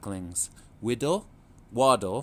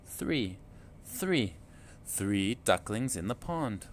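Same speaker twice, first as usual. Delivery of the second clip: plain reading voice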